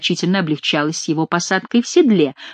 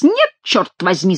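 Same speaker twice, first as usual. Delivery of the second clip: plain reading voice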